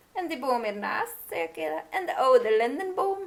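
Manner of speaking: a hint of the witch insisting; lightly voiced witch. Witch answers.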